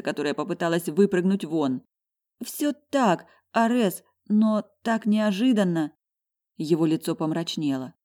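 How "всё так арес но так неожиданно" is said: high, slow, flustered gush. A narrator's reading. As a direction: gush it out overwhelmed. Overwhelmed heroine explains.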